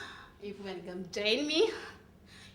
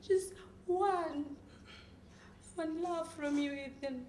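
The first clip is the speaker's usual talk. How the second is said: broken pleading; 10 dB quieter than usual. Acted. Acted begging, fading.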